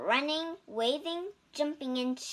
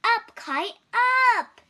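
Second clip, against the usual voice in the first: loud, higher cheer at the kite; shouting; 11 semitones higher, 12 dB louder than usual. A narrator's reading. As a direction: yell it up into the sky. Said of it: "He shouted".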